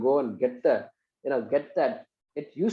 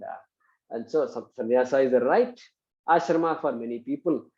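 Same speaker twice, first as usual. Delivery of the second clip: ordinary talk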